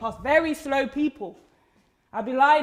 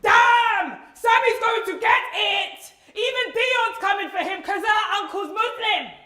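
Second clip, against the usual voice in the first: high, loud schoolyard heckle; jeering shout; 8 semitones higher, 6 dB louder than usual. Acted. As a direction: holler it over a jeering crowd of kids. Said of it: "through the jeers".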